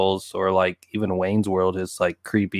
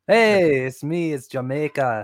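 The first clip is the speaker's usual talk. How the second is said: put-on playful Jamaican accent, higher on Hey; mock Jamaican accent; 3 semitones higher, slower than usual. Co-host imitating the actor's Jamaican accent, in a hammed-up Jamaican accent. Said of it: "I'm doing the Jamaican accent".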